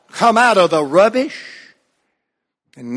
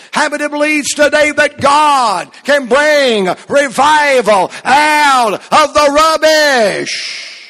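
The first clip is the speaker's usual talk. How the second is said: building to a shout; 5 semitones higher, 3 dB louder than usual. Acted. Preacher challenging his congregation, building to a shout.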